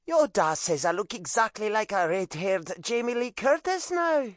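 high, coy Irish mother; preening Irish mom; Irish accent. A narrator's reading. Mom's voice.

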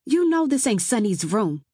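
irritated, climbing to a loud call; annoyed yell. Acted. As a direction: snap at the intruder, then yell for help. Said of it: "I yelled".

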